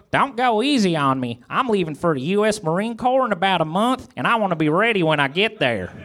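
high, lilting character voice; Gomer voice. A narrator's reading. Gomer urging Barney on before the Marines, high character voice.

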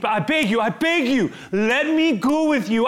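a desperate man begging Jesus out loud. Acted. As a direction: cry out your plea to be taken along. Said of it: "he's begging".

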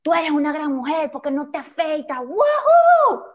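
put-on sarcastic girl-power cheer; mock cheerleader friend. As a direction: play the fake-supportive friend, then whoop. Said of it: "la amiga que va a decir".

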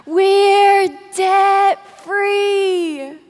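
high, loud, long-drawn celebratory scream; unison family scream. Retold or imitated. Family screaming into the radio microphone together, loud drawn-out scream. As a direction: draw each word out in a jubilant yell. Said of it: "just scream out together".

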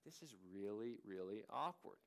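an uncomfortable aside at the table; awkward murmur. Retold or imitated. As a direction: mutter it under your breath, embarrassed. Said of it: "you gotta be sitting there like".

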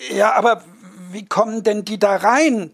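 normal talking voice

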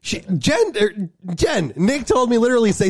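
high, rushed excuse; flustered. Real, not acted. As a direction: blurt out the excuse. Defending himself, flustered.

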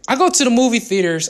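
ordinary delivery

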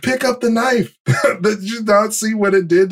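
exasperated fan calling out advice; yelling at the screen. Retold or imitated. Fan shouting at TV heroes to grab the knife, high urgent call.

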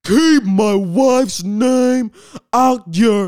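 loud, high, slow furious yell; hammed-up angry shout from the seat; Will Smith yelling. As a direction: bellow it like a man who just slapped someone. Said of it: "this man just went back to his seat".

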